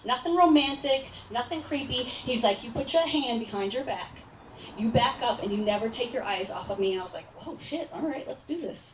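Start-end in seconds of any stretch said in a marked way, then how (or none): 2.6-6.9 softly imitated low-key commander; no-nonsense instructor. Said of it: "just pure leadership. No nonsense". 7.4-8.8 very soft retold muttering to oneself; hushed self-talk. Retold nervous self-talk on stadium.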